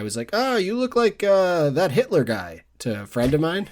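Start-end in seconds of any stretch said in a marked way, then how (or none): 0.3-2.6 lightly voiced diner owner, pitched up high; high-pitched retold exclamation; Greek diner owner. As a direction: mimic the diner owner's higher-pitched remark. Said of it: "who's this Greek guy".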